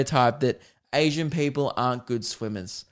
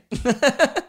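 high-pitched burst of laughing; real giggling fit; high laughter; 12 semitones higher, 3 dB louder than usual. Real, not acted. Laughs at the retold outrage.

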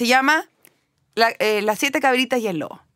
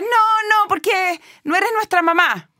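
put-on goat kids refusing; 6 semitones higher than usual. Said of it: "Y las cabritas".

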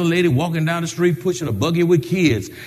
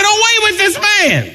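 higher, loud crowd cry; crowd shout; 13 semitones higher, 3 dB louder than usual. Retold or imitated. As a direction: shout it like a mob. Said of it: "They said".

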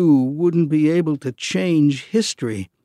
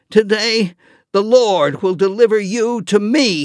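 higher, louder confident vow; bold declaration; 7 semitones higher, 5 dB louder than usual. Acted. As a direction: proclaim it fearlessly. David faces Goliath.

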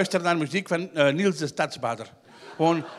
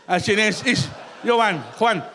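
ordinary talk

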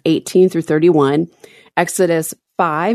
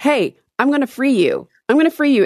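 bright, higher promising voice; upbeat Moses; 8 semitones higher, faster than usual. Retold or imitated. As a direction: sell the big promise cheerfully. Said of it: "This man comes in and says".

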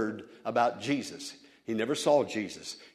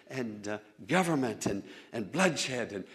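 ordinary talk

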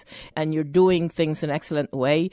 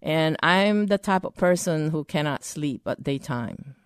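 normal talking voice

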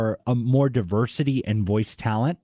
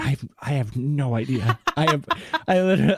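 laughing; 3 semitones higher than usual. Real, not acted. Laughing at own accent attempt.

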